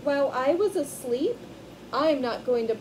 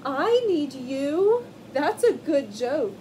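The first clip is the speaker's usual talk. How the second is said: amused lion; 3 semitones higher, 7 dB louder, slower than usual. Acted. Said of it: "Lion laughed and laughed".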